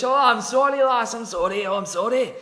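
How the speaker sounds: put-on loud, high comic Scottish accent; hammed-up Scottish apology. Alfred apologising to the lass, broadly Scottish. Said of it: "I'm gonna make him Scottish".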